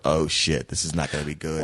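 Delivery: low, sinking groan of dread; dismayed aside. Retold or imitated. Inner thought when seeing her cast.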